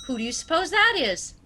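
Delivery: ordinary talk, nothing marked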